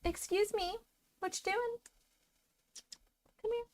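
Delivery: quiet, high coaxing to a pet. Real, not acted. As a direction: coo softly at the cat. Talking softly to a cat.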